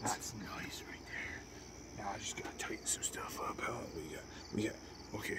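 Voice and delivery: unvoiced whisper under the breath. Real, not acted. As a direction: murmur it to yourself. Whispering to himself at work.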